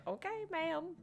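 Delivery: a lightly voiced obedient underling; meek reply. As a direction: answer like a cowed child to a stern boss.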